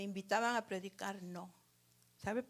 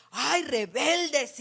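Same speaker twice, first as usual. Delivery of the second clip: slow, loud furious outburst; angry rebuke; 5 semitones higher, 13 dB louder, much slower than usual. Retold or imitated. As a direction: drag it out like Moses fed up with the people. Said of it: "él se enojó tanto con el pueblo".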